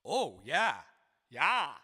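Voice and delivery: ordinary talk, nothing marked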